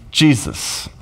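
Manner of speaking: normal talking voice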